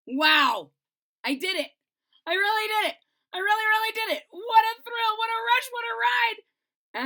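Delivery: loud, high triumphant cheer; thrilled. Acted. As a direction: shout your joy after the ride. Thrilled after the slide.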